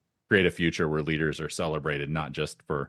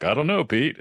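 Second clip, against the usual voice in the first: high stiff robot voice; 9 semitones higher, 3 dB louder than usual. Retold or imitated. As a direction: answer the boss like a robot. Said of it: "talking in a robot voice".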